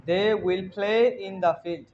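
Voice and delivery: slow, word by word model; slow model sentence. Shown as a demonstration. Teacher modelling the translation slowly for the class. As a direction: lay it down slowly for the learners.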